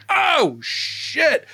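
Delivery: high-pitched drawn-out exclamation of shock; shocked reaction. Retold or imitated. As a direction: react like a stunned kid. Recalls his shocked reaction as a child.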